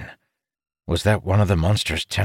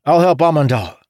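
urgent, raised voice; 8 semitones higher, 5 dB louder than usual. A narrator's reading. Ilden in battle.

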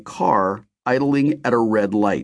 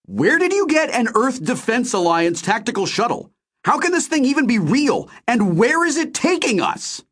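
high-pitched angry shouting. A narrator's reading. Narrator confronts Ray about the shuttle, shouting. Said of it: "I started shouting".

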